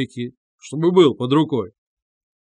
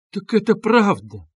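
high-pitched astonished exclamation; startled cry; 7 semitones higher than usual. A narrator's reading. Mother learning her guest was thrown out, crying out. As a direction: cry out in sudden dismay. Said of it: "вскричала Пульхерия Александровна".

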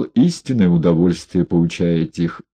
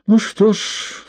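sigh; 13 semitones higher, slower than usual. A narrator's reading. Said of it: "вздохнул Егоров".

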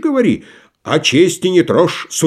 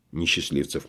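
usual speaking voice

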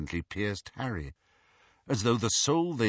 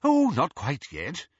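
smiling; 6 semitones higher, 4 dB louder than usual. Acted.

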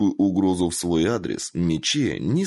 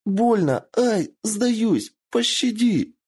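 begging in pain; much slower than usual. A narrator's reading. Kitsune crushed by Michiyo, squealing for mercy. Kitsune begs while bones crack.

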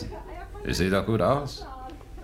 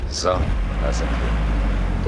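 slow, drawn-out; 6 semitones lower, 5 dB louder, much slower than usual. Acted. Arrival at the hideout.

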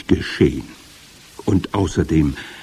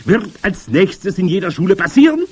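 high, loud rhetorical question; forceful high witch voice; calling over noise; 13 semitones higher, 6 dB louder than usual. A narrator's reading. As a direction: throw the question into the clamour. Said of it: "in den Lärm".

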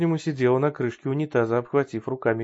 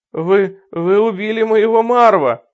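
loud, higher hysterical wail; hysterical sobbing; 8 semitones higher, 10 dB louder, slower than usual. Acted. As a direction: cry out in hysterics. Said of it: "рыдающей в истерике".

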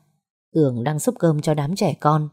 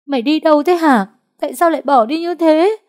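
much higher, louder wailing reproach; bursting into tears; 11 semitones higher, 6 dB louder than usual. A narrator's reading. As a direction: cry out like a child while scolding. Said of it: "Nó khóc òa lên như đứa trẻ con". (giọng khóc òa, trách móc)

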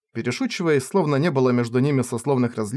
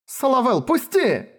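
crying out; 11 semitones higher than usual. Acted. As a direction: shout to be let go. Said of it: "вскричала она".

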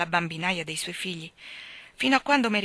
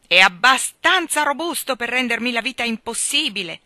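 loud, higher angry retort; irate; 5 semitones higher, 9 dB louder than usual. A narrator's reading. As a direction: spit it back. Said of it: "disse il signor Banks irosamente". (irato e forte)

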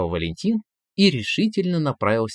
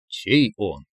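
echoed interest; 3 semitones lower than usual. A narrator's reading. Man repeating his prompter's words, same interested intonation. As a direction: lower copy of a curious question. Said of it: "Валентин даже интонации сохранил".

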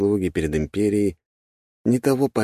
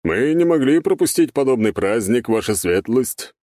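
smiling guest, raised expansive voice; Павел Александрович; 6 semitones higher, 3 dB louder than usual. A narrator's reading. Governor greets the prince at his party, smiling and higher-pitched. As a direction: congratulate with a higher, smiling courtesy. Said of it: "улыбнулся Павел Александрович".

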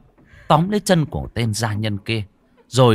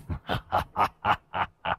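demon cackle; 5 dB quieter than usual. A narrator's reading. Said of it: "lệ quỷ kia cười sằng sặc". (cười sằng sặc kiểu quỷ)

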